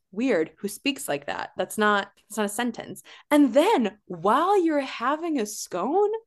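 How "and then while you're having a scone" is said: mocking disbelief. Real, not acted. Mocking Jeff's odd line.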